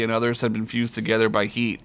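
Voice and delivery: ordinary delivery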